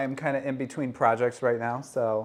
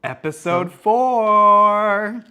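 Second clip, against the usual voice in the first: slow announcer voice; 8 semitones higher, 11 dB louder, much slower than usual. Acted. Show title announcement.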